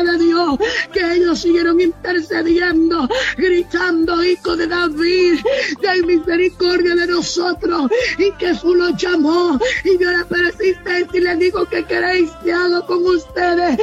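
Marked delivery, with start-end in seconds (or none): none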